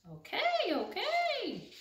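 calm the pigeon down like a small chick; meek duckling. Acted. The duckling backing off from the cranky pigeon, high and meek.

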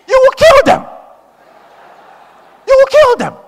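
loud, very high shouted accusation; furious rebuke. Retold or imitated. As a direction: shout it as a damning charge. Acted furious rebuke of the sister.

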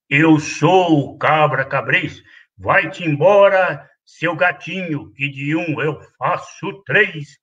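low, slow, gruff goat threat; menacing billy goat. Acted. Bully goat chasing the kid away, slow low threat. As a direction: loom over him, slow and low.

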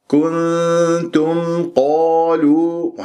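slow recited كنتم قالوا on a level tone; clear meem before qaf. Shown as a demonstration. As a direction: hold the n of كنتم, close the m cleanly into a heavy قالوا. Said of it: "والميم مظهرة بعدها قاف".

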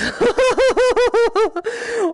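long, loud high cackle of amusement; real giggling fit; high-pitched laughter. Real, not acted. Cracks up at painted nails.

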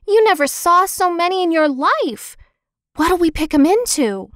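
put-on high, eager kid's voice; excited child's call. A narrator's reading. Benny thrilled at the thick blueberries, high and eager. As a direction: gush about all the berries. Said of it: "screamed Benny".

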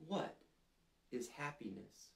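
ordinary delivery, nothing marked

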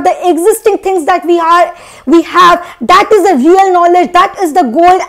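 ordinary talk

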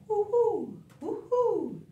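high, slow hooting; owl hoot. Pretend. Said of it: "like an owl".

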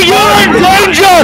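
high-pitched mock warning shout; mock alarm. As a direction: shout a pretend danger warning. Mock warning in airport bit.

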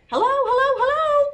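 high, loud excited greeting call; chipmunk shouting hello. A narrator's reading. Jitterbug calling to Toast the butterfly, shouting. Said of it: "shouted Jitterbug".

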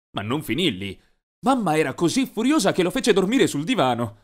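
plain reading voice

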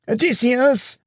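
high, sharp warning call; warning shout. Acted. Companion shouts a warning to Odysseus, high call. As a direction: shout to warn him. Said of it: "came a warning shout".

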